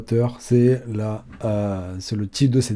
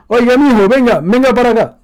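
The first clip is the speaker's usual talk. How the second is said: much higher and louder call, Ecuadorian style; Ecuadorian accent; 12 semitones higher, 8 dB louder than usual. Shown as a demonstration. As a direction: hail someone across the street. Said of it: "c'est beaucoup plus poli".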